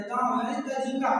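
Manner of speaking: usual speaking voice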